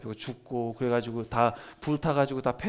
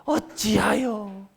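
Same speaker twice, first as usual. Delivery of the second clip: slow lament; 8 semitones higher, 5 dB louder, much slower than usual. Shown as a demonstration. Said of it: "이 책의 제목이".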